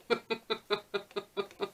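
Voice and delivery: quiet breathy chuckle; a short soft laugh. Real, not acted. Laughing at own mishap.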